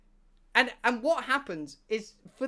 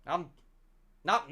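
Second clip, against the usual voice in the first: ordinary talk, nothing marked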